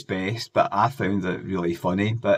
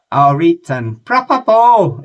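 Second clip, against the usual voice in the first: much higher, louder, slow exaggerated impression; 10 semitones higher, 13 dB louder, slower than usual. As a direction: ham up a mask-faced pop star's catchphrase. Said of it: "[name]'s was".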